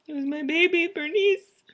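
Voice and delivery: high, loud pouty cry; mock-sad wail. Owner seeing her car on the tow truck, mock-sad wail. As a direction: wail it like a hammed-up mourner. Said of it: "So sad".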